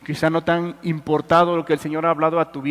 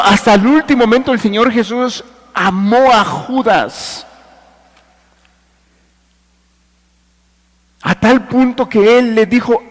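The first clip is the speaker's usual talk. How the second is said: raised, emphatic sermon voice; forceful preaching; 6 semitones higher, 6 dB louder than usual. Real, not acted.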